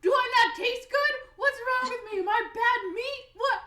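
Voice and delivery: very high, loud offended yelling; mad, acted. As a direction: shriek comic outrage at being rejected. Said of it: "He's, he's so mad".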